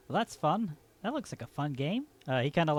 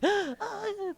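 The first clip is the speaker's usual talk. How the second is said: put-on kids freaking out. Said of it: "the kids kinda go like".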